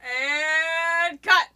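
loud, high, delighted director; big projected wrap-up call; director calling cut. Acted. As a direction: call out cut and praise the take. Director ending the acted scene.

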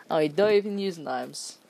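ordinary delivery

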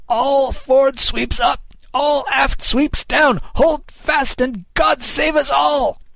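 very high, drawn-out captain's cry; desperate shouted orders. A narrator's reading. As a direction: bellow each order long before the crash. Captain's desperate orders before crash.

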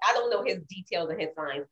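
ordinary talk, nothing marked

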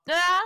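high, drawn-out "aah"; mock yell. Player has Fairy run with everyone, mock yell. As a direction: let out a loud pretend running yell. Fairy runs along with the crowd.

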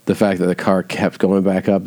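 ordinary talk

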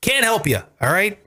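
ordinary delivery

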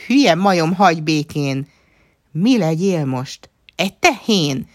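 high, loud angry squeal; screeching. A narrator's reading. Nana turns on laughing Lia, screeching. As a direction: squeal the taunts in a temper. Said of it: "visította Nana".

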